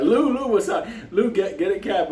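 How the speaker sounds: lively mock shout; excited greeting. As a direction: hail him across the room. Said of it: "I was like".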